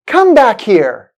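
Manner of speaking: loud, slow command, "Come" high and falling through "here"; falling intonation. Shown as a demonstration. Teacher models a command for learners to repeat, falling intonation. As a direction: order it firmly, starting high and dropping on "here". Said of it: "sounds like a fall in the pitch".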